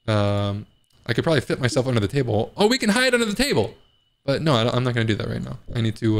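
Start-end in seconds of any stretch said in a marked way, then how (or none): 2.6-3.7 high, quick burst of an idea; excited. Real, not acted. Player spotting a new hiding place, excited. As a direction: blurt it out high and fast.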